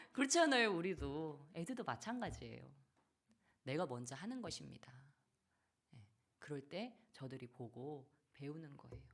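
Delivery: ordinary talk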